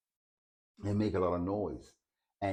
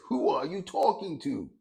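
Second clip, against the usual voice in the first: pitched high, a confrontational who are you talking to; angry challenge; 10 semitones higher, 3 dB louder than usual. Retold or imitated. Street person challenging the old man, high angry demand. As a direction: demand it much higher, with rising anger.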